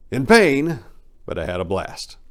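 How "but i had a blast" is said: plain reading voice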